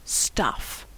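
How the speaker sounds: very soft, low, scornful; sarcastic quote marks round it; mocking air quotes. A narrator's reading. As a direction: drop it with quiet disdain. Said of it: "made big fake quotes with her fingers".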